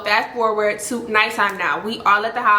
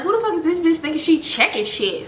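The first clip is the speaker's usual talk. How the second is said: retold indignant disbelief, higher pitch; irritated; 6 semitones higher than usual. Storyteller replays her thought about being checked, irritated. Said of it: "I'm getting irritated".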